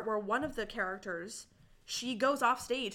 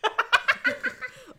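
high embarrassed laugh; 14 semitones higher, 6 dB louder than usual. Real, not acted.

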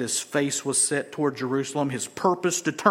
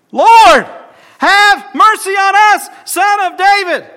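high, loud pleading shout; desperate cry; 14 semitones higher, 13 dB louder than usual. Acted. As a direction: cry out for mercy with all you have. Blind men crying out.

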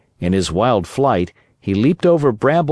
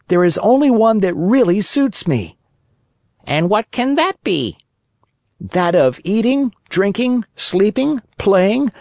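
higher, lightly voiced brat brushing off advice; cheeky puppet boy; 8 semitones higher than usual. Pinocchio scoffing at the cricket's warning, cheeky higher voice.

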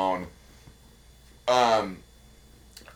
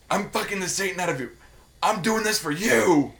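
mock passionate; 8 semitones higher than usual. [name] exorcising Finn, mock passionate.

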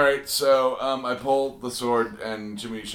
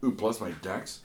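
ordinary talk, nothing marked

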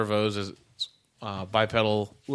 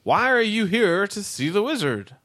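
high chirpy robot voice; bright machine greeter, pitched up; 9 semitones higher than usual. Acted.

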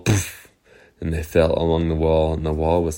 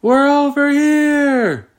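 high, slow, loud call; 5 dB louder, slower than usual. Acted. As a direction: call out to be found. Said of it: "They said".

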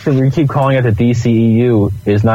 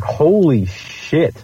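slow, high, drawn-out astonishment; stunned reaction; 6 semitones higher, much slower than usual. Retold or imitated. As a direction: relive being floored, stretching the words. Retold reaction to casting news.

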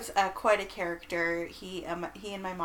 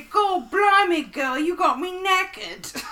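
slow, much higher, louder Cockney; Cockney stepdad; 11 semitones higher, 11 dB louder, slower than usual. Retold or imitated. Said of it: "very Cockney accent".

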